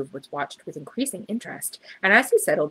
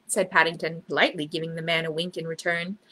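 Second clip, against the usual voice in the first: ordinary delivery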